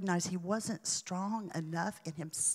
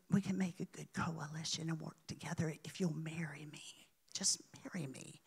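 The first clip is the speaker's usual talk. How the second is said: whispered seduction; 7 dB quieter, faster than usual. Retold or imitated. Serpent woman seducing in trance.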